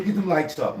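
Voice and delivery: normal talking voice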